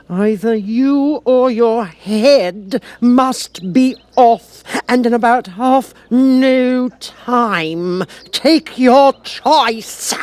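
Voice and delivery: high, loud, slow royal ultimatum; furious queen. A narrator's reading. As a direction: lay down the law, dragging every word. Queen threatens the Duchess.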